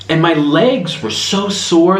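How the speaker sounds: ordinary talk, nothing marked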